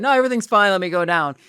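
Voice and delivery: chirpy fake reassurance; husband cheerfully brushing off his wife; mock breezy husband. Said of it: "you're supposed to be like".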